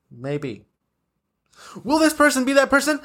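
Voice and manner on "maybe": "Maybe" dropped low and soft; soft, low afterthought "Maybe"; subdued maybe. Real, not acted. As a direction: say "Maybe" low and quiet, deflated. Answers own question, subdued take.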